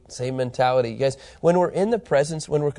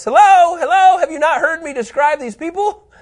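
very high, loud exasperated "hello?"; hammed-up high-pitched disbelief; incredulous calling out; 10 dB louder than usual. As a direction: call out as if nobody has been listening. Acts out exasperated appeal to listeners.

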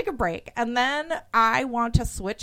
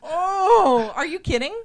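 panicked; 8 semitones higher, 4 dB louder, slower than usual. Real, not acted. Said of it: "I just totally freaked out".

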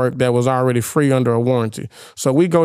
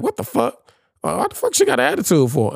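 shocked disbelief; 8 semitones higher than usual. Retold or imitated. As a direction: act stunned and baffled. Shocked inner reaction retold.

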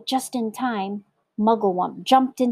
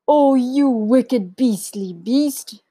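slow, loud, raised denunciation; outraged elephant cry; 5 semitones higher, 6 dB louder, slower than usual. A narrator's reading. Elephant condemning the child-eating crocodile, outraged cry. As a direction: cry out in drawn-out indignation. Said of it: "cried Trunky".

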